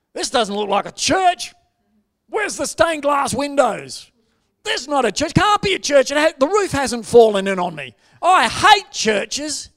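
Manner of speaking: loud, high outburst; angry ranting man. Retold or imitated. Angry visitor ranting at a pastor about the church, loud and high. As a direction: rant it loud, pitched right up, leaning on key words. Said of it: "started swearing and carrying on".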